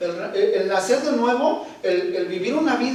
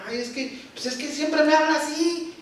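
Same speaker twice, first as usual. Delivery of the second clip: mock whining complaint, a bit higher; whiny victim voice; 6 semitones higher than usual. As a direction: play the self-pitying victim. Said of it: "Nos hacemos". (imitando a una víctima quejumbrosa)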